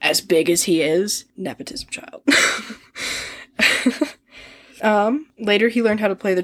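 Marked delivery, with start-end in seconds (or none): 1.4-2.2 a sly whispered jab; whispered aside. Real, not acted. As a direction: whisper the punchline. Punchline, repeated joke.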